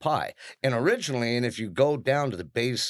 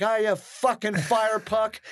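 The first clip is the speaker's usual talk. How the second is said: groggy retort; 12 semitones higher, slower than usual. Retold or imitated. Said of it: "I'm like".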